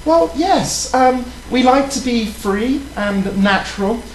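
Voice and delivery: prim, proper British lady voice; British accent, ladylike; put-on British accent. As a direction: play a posh English lady flirting. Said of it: "the accent is annoying the crap out of me".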